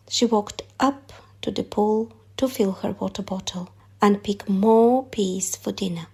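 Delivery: usual speaking voice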